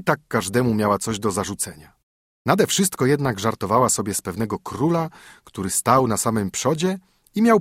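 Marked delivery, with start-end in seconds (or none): none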